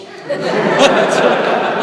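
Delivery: bursting into a loud high laugh; big laugh. Real, not acted. Host laughing loudly at his own punchline.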